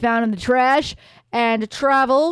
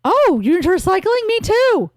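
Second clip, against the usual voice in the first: put-on high, louder eager girl voice; delighted young woman, pitched up; excited Aerith; 9 semitones higher, 4 dB louder than usual. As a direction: squeal it happily.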